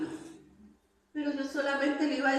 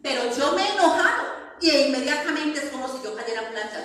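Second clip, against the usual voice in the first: ordinary talk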